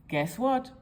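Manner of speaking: ordinary delivery, nothing marked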